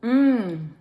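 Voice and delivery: appreciative loud hum; savoring mm; loud mm of delight. Real, not acted. Tasting the food.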